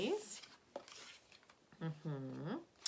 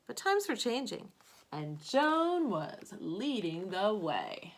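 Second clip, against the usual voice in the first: expressive picture-book narration; storybook read-aloud voice; reading to children; 7 dB louder than usual. Acted. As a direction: read it aloud to a class of kids. Reads picture book to class.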